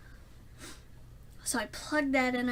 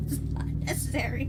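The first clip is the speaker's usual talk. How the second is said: whispered aside. Real, not acted. Aside after heat sink warning.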